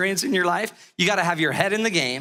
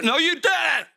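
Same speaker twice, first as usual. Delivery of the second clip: mock outraged shout; 10 semitones higher, slower than usual. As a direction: snap back at the son in mock outrage. Exasperated retort to son.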